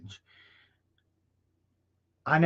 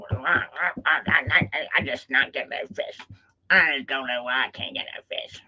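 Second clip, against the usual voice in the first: frustrated mutter. Acted. Frustrated fisherman muttering to himself.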